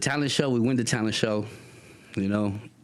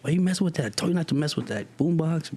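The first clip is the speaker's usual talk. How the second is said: high-pitched acted reproach; arguing; 7 semitones higher, faster than usual. Kids in trouble blaming each other over a boombox, arguing. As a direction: scold a friend in a raised, exasperated pitch. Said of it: "Now we're arguing".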